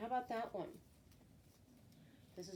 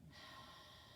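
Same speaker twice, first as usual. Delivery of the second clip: long voiceless puff of air; breathy H sound; 15 dB quieter than usual. Shown as a demonstration. Teacher demonstrating the letter H, soft long breath out. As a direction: exhale softly as a drawn-out "hhh". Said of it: "The H makes this sound".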